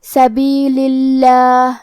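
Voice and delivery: level chant of سبیل اللہ, long thin laa; thin lam. Shown as a demonstration. Teacher demonstrating thin lam, slow tajweed recitation. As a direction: slowly recite سبیل اللہ with a light l in Allah. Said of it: "پتلی آواز میں پڑھیں گے".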